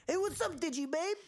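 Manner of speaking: very high sleazy cartoon imp voice; cheesy little imp hitting on her; Impmon flirting. Host voicing Impmon flirting with Renamon, very high. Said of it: "is like".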